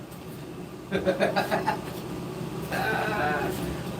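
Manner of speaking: high chuckle trailing into breath; real laugh. Real, not acted. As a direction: laugh until the air runs out. Cracks up after slang outburst.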